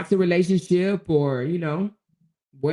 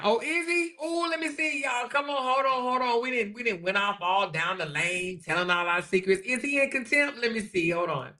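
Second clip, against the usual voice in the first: eager gossip squeal; 5 semitones higher, 4 dB quieter than usual. Real, not acted. Excitedly checking for gossip.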